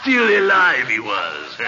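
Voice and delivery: usual speaking voice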